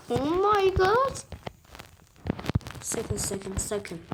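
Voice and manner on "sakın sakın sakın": lower, much softer sakın; tense low pleading. Real, not acted. Gamer begging his character, quiet tense pleading. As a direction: plead under the breath. Begging the game not to fail.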